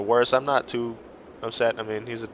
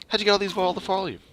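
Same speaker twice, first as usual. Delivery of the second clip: high, fast curious question; a young one eagerly asks Krumer, high and quick; 6 semitones higher, 4 dB quieter, faster than usual. Acted.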